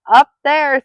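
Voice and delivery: high, loud little outburst; frightened Piglet. A narrator's reading. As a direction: blurt it out in alarm. Frightened Piglet points to the danger.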